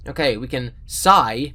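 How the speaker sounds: ordinary delivery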